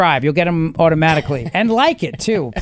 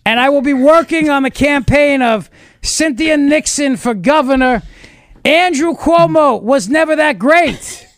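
Cuomo impression; 6 semitones higher than usual. Host doing Cuomo impression.

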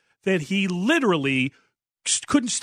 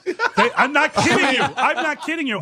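laughing; 8 semitones higher than usual. Real, not acted. Laughing while telling story.

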